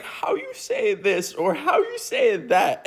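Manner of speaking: slow, lightly put-on Spanish accent in broken English; mock Spanish accent. Friend back from Spain, mock Spanish accent. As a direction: imitate a friend faking lost English. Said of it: "She kept on going like".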